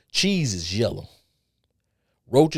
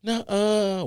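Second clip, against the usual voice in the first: 1 disbelieving nuh-uh; 12 semitones higher than usual. Retold or imitated. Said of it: "I was like".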